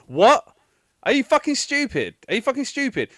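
high, loud exasperated disbelief; incredulous outburst. Real, not acted. Ranting about the woman who just stood there, incredulous outburst. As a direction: burst out at the stupidity. Outburst after mocked inner monologue.

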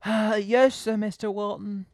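high, loud, drawn-out protest sinking into reluctant obedience; hammed-up crestfallen trainee; dismayed young assistant. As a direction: act out a let-down eager helper. Acting Simon's dismayed reply.

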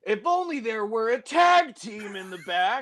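high-pitched, pointed hinting; hinting X-Pac. Retold or imitated. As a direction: drop a heavy hint. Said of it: "X-Pac goes".

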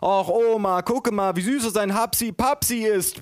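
put-on high, gushing baby-talk mother; mock yell in a high mother voice; bellowing mother. A narrator's reading. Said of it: "brüllte meine Mutter".

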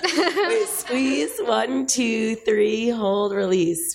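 strained high counting, slow release; Kegel count. Shown as a demonstration. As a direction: count the squeezes like a workout. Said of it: "I'm like".

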